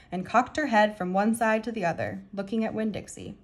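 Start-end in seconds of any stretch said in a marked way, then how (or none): none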